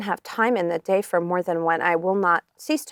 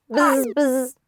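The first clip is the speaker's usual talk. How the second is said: bee voice; 5 semitones higher than usual. Acted.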